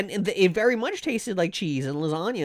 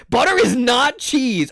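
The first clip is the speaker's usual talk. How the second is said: louder, slower, each word insisted on; indignant; 6 semitones higher, 6 dB louder, slower than usual. Real, not acted. Streamer insisting to chat, loud and indignant. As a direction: hammer home that it's wrong. Arguing with chat about cheese.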